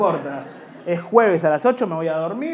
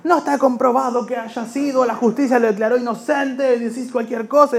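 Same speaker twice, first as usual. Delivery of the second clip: higher defensive voice; higher outraged fan; indignant fan; 6 semitones higher than usual. Acted. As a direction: protest like an offended fan. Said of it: "que pone".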